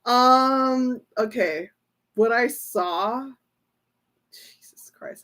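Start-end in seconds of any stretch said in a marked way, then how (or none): 4.3-5.2 a stunned, voiceless gasp of a curse; shocked whisper. Real, not acted. Stunned by a tarot reading, she whispers to viewers. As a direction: breathe it out with no voice, stunned.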